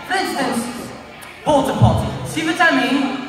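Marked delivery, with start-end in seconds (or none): 1.4-2.1 PORTA POTTY in refined high-class British. Shown as a demonstration. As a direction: make porta potty sound sophisticated, upper-class British. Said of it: "everything you say in a high-class British accent".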